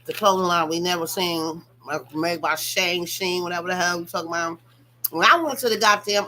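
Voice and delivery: normal talking voice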